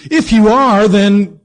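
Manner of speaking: high and loud, a jeering challenge; taunting tempter. Acted. The enemy daring Jesus to prove himself, loud jeer. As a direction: throw the dare in his face.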